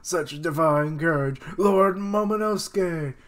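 high, loud weepy lament; hammed-up tearful admiration; mock crying. Onlookers lament the brave boy, mock crying. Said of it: "everyone's crying".

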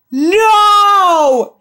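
high, loud cry of No; shocked shout. Real, not acted. Fan watching the show shouts in shock, loud. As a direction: yell it out in real shock.

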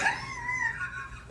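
shrill laughing outburst; high burst of laughter. Real, not acted.

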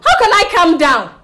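high, loud exasperation; agitated retort. Acted. Upset woman rejecting her friend's advice, agitated. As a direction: protest hotly at being told to relax. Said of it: "Don't talk like this".

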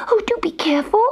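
high, airy kitten plea; fretful little cat's warning; anxious cry. A narrator's reading. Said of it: "cried Gobelino".

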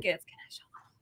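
hushed unvoiced aside; whispering. Real, not acted. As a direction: whisper it off to the side. Quick aside to co-host off mic.

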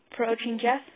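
ordinary delivery, nothing marked

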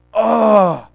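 long, drawn-out acted groan; exaggerated reluctant groan; groan of dread. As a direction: groan at an unwanted duty. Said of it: "you dread it".

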